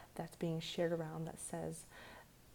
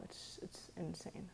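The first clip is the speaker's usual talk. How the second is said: breathy hushed disbelief; whispered; 10 dB quieter, slower than usual. Real, not acted. Whispered then repeated aloud.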